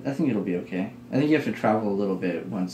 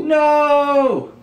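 loud, very high wail of despair; anguished shout; 9 dB louder than usual. Real, not acted. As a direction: scream it in horror. Beloved companion Glenn dies.

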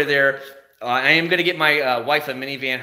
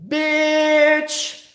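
a sudden high shrieked insult; a hammed-up high squeal at the other driver; high mock yell; 14 semitones higher, 4 dB louder than usual. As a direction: yell it out as you floor it. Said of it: "I was like".

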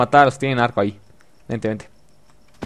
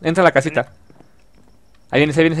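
ordinary talk

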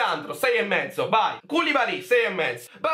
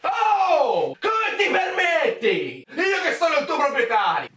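mock outrage; 7 semitones higher than usual.

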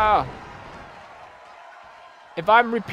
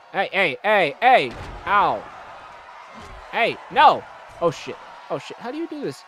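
normal talking voice

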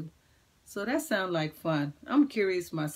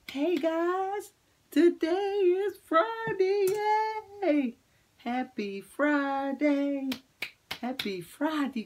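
put-on high, bright kids show cheer; excited singsong; 8 semitones higher, 4 dB louder, slower than usual. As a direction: sing out the day, thrilled.

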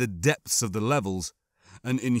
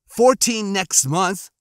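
higher, louder, slow, indignant; indignant flare; 8 semitones higher, 6 dB louder, slower than usual. A narrator's reading. Boy defending his age to his brother, indignant and louder. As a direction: push back hard on being called young. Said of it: "another flare of emotion".

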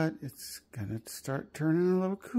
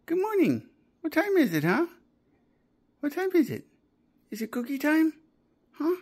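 talking to a dog; 11 semitones higher, 4 dB louder than usual. Real, not acted. Owner offers dog a cookie.

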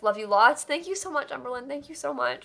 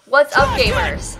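loud, slow YouTuber greeting yell; gamer shout; 8 dB louder, slower than usual. Pretend. Streamer doing a gamer greeting for chat, loud mock shout. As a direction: bellow it like a gamer intro.

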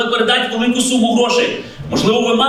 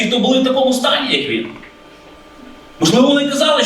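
ordinary talk

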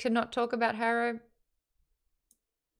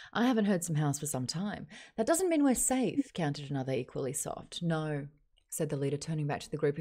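measured, lower Australian newsreader voice; 4 semitones lower, 4 dB quieter than usual. Shown as a demonstration. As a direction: deliver it as a TV news report. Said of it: "That's a newsreader voice in Australia".

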